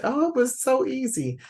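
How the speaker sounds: high, sing-song sarcastic chirp; sugarcoating it with a mocking lilt; mock breezy. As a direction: pretend it was a breeze. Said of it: "I'm not gonna sugarcoat it and say".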